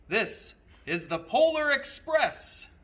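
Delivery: booming conductor announcement; grand announcement. Acted. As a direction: announce the train proudly. Conductor announces train name.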